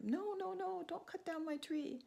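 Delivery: high, slow pleading bird voice. Acted. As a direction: beg like a little bird for your tree.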